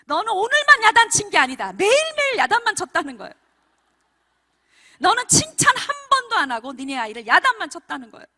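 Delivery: loud, high, emphatic rebuke; retold divine scolding. God rebuking a repentant mother for only scolding her child, loud scolding. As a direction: scold forcefully as God's retold voice. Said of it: "하나님이 더 야단치시는 거예요".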